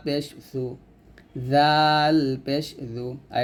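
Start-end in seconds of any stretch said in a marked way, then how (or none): 1.5-3.1 জাল পেশ জু said slowly and light; thin-letter drill; thin light demo. Shown as a demonstration. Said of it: "এটা হবে পাতলা".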